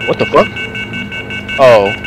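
normal talking voice

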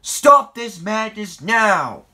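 high, slow, booming order; loud commanding voice. A narrator's reading. Boss halting the fighting engines, loud command. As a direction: shout them all to a halt. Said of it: "a loud voice".

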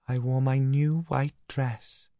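put-on a dreamy ghost girl remembering; new voice. A narrator's reading. Dead sister Katinka speaks from the photograph, high and slow. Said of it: "a new voice said".

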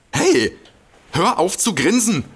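high-pitched annoyed yelp; indignant outburst. Acted. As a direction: snap at him to stop grinning. Stan scolds grinning friend.

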